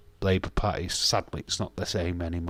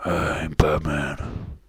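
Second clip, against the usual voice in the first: low, very slow movie Batman voice; 4 semitones lower, much slower than usual. Retold or imitated. Streamer imitating Batman for chat, low and very slow. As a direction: drag it out low like the caped hero. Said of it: "and he talks like this".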